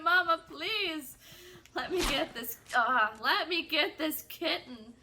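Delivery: loud, hammed-up begging; dramatic plea. Child begs mom for a kitten, mock-dramatic loud plea. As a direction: plead like an overacting theater kid. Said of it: "as loudly and as dramatically as possible".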